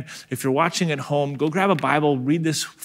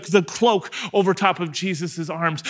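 usual speaking voice

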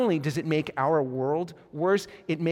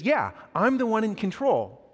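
mildly heated retort, pitched up; retold anger; 6 semitones higher than usual. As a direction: assert control with a touch of anger. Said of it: "gets really angry".

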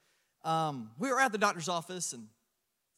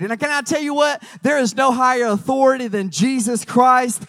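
loud, higher, rousing preaching; full-force preaching; 5 semitones higher, 11 dB louder than usual. Acted. Preacher declaring Jesus's authority, rousing loud preaching. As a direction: fire up the congregation.

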